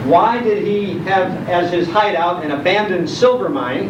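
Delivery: normal talking voice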